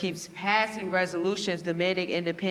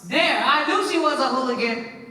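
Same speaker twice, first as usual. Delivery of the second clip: loud, higher, triumphant scolding; angry outburst; 6 semitones higher, 5 dB louder than usual. Acted. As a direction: snap it out as if proved right. Uncle scolds the girl angrily.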